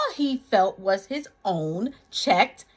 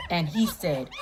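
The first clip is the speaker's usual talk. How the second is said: ordinary delivery, nothing marked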